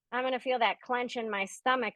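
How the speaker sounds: ordinary talk, nothing marked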